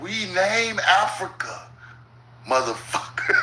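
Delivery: slowed down for emphasis. Shown as a demonstration. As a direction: say it again, slowly and plainly. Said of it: "Let me say it a little slow".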